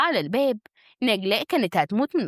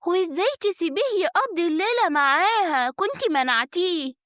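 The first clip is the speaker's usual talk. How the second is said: raised, mildly scolding woman's voice; reproachful friend; 7 semitones higher than usual. Acted. Friend on the phone scolds the wife, reproachful. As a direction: chide her in a higher voice.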